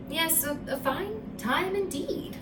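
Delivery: ordinary delivery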